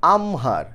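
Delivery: আমহার as "am-har", h clearly sounded; correct pronunciation. Shown as a demonstration. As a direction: close the m, then release an h in আমহার. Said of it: "এই উচ্চারণ কি?".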